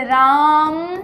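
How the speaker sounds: stretched long call of राम; pluta call. Shown as a demonstration. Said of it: "प्लुत के लिए आप ये जो तीन इकाई". (राम को लंबा खींचकर पुकारना)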